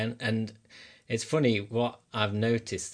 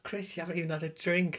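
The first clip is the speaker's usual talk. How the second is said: incredulous friends; 8 semitones higher, 3 dB quieter than usual. Retold or imitated. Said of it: "and they're like".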